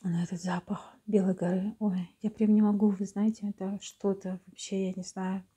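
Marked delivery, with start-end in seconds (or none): 0.0-1.8 hushed whisper, lingering on белой. Real, not acted. Savouring the smell of chalk.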